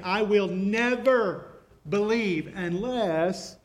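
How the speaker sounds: very slow, never stretched out and falling; Thomas's stubborn vow, slowed right down; stress on never. Acted. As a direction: drag out I will never as a vow. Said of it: "you can almost hear audibly Thomas saying".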